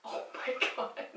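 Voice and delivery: breathy whispered swoon. Retold or imitated. Fan reacting to the car scene in an awed whisper. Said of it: "I was like".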